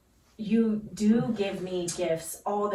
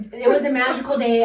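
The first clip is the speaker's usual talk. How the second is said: laughing; 4 semitones higher, 6 dB louder, faster than usual. Real, not acted. Laughing while retelling the day.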